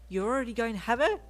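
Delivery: high and loud in disbelief; shocked gasp. Acted. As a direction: blurt it out, stunned. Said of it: "he gasped".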